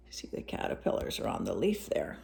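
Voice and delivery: soft whispered aside. Real, not acted. Parent pointing at the picture, whispering.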